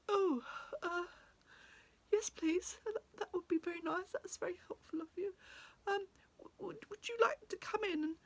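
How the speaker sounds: high, halting, sniffly voice; hesitant high-voiced woman recovering from tears; tearful grateful woman. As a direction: stammer it out shyly after a good cry. Weeping woman, now grateful, answers haltingly.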